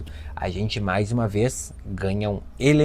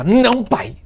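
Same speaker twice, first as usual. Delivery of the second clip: much higher, louder indignant outburst; angry teen; 12 semitones higher, 4 dB louder than usual. Retold or imitated. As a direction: snap back like an offended teenager. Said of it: "Aí já fica brava".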